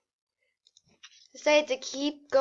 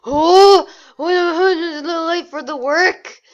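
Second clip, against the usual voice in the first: loud, higher mock yell at the worker; boss yelling; 3 semitones higher, 10 dB louder than usual. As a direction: bellow it like an angry boss. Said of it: "started to yell at him".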